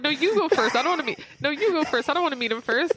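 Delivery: mock nervous pleading. Retelling stars refusing to go first, mock nervous. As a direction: play two shy stars, each pushing the other ahead. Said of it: "They're pushing each other like".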